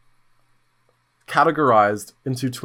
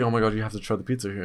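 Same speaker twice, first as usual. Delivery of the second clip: fast excited rush; 4 semitones lower, 6 dB quieter, much faster than usual. Retold or imitated. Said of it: "she was like".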